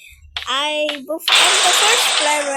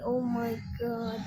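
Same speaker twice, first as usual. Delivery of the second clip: ordinary talk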